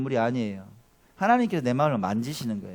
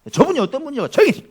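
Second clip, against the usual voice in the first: sharp high shout, much louder; scolding crowd; 10 semitones higher, 7 dB louder, faster than usual. Pretend. Crowd hushing Bartimaeus, a high mock yell. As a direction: snap at him to shut up. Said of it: "막 이렇게 했을 것 같아요".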